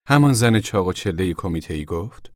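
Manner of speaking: ordinary delivery, nothing marked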